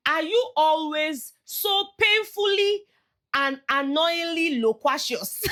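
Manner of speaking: high, slow, stretched-out words; mocking high drawn-out question; mock posh question. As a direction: savour every long word in a mocking tone. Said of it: "Denari now asked Mensan".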